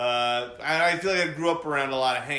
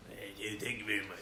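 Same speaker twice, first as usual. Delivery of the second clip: quiet, lower, mumbled Sandler impression; a weak Adam Sandler thank-you; 4 semitones lower, 10 dB quieter than usual. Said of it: "I don't do a very good Adam Sandler".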